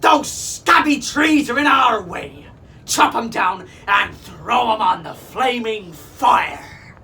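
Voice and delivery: loud, high, slow pirate bellow. A narrator's reading. Captain bellowing orders to his men, loud bellow. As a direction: roar it across the jungle. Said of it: "heard the captain bellow".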